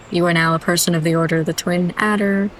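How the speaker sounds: usual speaking voice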